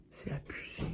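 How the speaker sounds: very soft, voiceless whisper; exasperated whisper. Real, not acted. Vlogger despairing to viewers over a cringe series, exasperated whisper. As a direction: whisper it under your breath.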